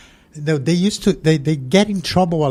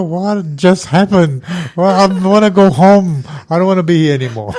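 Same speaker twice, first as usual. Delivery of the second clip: stunned, half laughing; 6 semitones higher, 8 dB louder than usual. Retold or imitated. Shocked newcomer wanting to go home.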